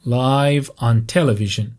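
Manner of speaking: slow "live" said as "laiv", like "five"; lajv for live. Shown as a demonstration. Teacher modelling the adjective reading, live as "laiv". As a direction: say live with a long "ai" sound. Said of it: "تو این جمله بگیم لایو".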